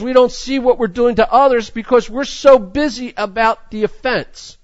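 fervent sermon delivery; preaching. Real, not acted. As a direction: press the point home from the pulpit. Preaching at full force.

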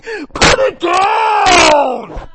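slow, loud booming command; the Spirit's slow, booming order; commanding shout. Retold or imitated. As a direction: bellow it like a stern father. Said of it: "the Spirit's going".

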